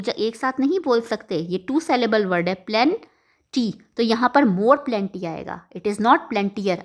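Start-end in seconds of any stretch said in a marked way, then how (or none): 5.8-6.8 slow, with not leaned on hard; stress on not. Shown as a demonstration. Teacher to students correcting her mistake: slow stress on not. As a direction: press the word not, slowly correcting. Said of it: "no, it is not plentier".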